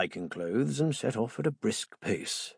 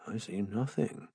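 muttered softly; 7 dB quieter than usual. Acted. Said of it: "I muttered at him softly".

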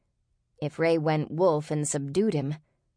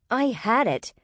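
high, heated objection; indignant, irritated retort; growled protest; 7 semitones higher than usual. A narrator's reading. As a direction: protest angrily at the one to blame. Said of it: "Ray growled at him".

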